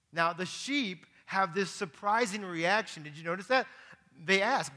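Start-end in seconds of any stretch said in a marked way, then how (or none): none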